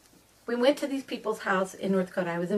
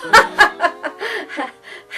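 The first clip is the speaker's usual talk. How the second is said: loud burst of laughter; 10 semitones higher, 9 dB louder than usual. Real, not acted. Laughs at her own joke.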